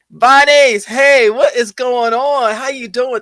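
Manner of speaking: high, loud and slow hey; excited greeting. Real, not acted. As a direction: call out to a friend in chat. Greeting a chatter.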